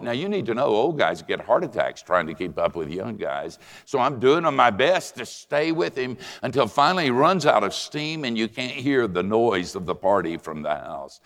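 ordinary talk, nothing marked

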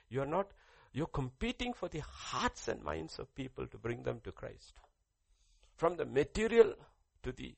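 loud, high, driving sermon voice; impassioned preaching. Acted. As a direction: hammer each point home to the church. Preaching at full force.

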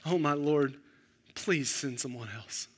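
a hushed, low, desperate plea that fades away; desperate pleading. Retold or imitated. Moses quietly begging God to send someone else. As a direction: beg quietly, voice dropping low. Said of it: "can you hear him getting desperate here".

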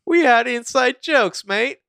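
very high, slow, loud mock Cockney; Cockney accent. Co-host playing an English Ezra, hammed-up Cockney accent. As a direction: whine it out in exaggerated Cockney. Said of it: "started doing a Cockney accent".

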